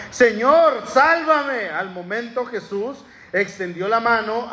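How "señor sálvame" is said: desperate plea. A narrator's reading. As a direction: call out as you sink. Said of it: "dio voces diciendo".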